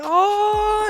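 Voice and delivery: high, drawn-out mock "oh"; imitated "oh" reaction. Retelling friend's reaction to the joke, high mock "oh". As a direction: stretch a high "ohhh" in imitation. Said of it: "y él empezó a hacer".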